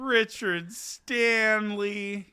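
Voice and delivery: slow disapproving name drop; disgusted drawl. Real, not acted. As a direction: say the name slowly with scorn. Disgusted at the news.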